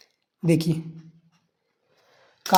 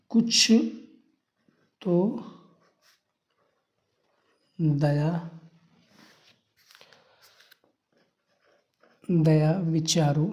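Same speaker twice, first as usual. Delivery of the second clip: कुछ तो दया विचारो spelled out word by word, very slow and softer, long gaps; slow softer dictation, word by word, for students to copy; 2 semitones lower, 5 dB quieter, much slower than usual. Shown as a demonstration. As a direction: dictate it slowly while writing on the board. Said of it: "यही लिख देंगे student".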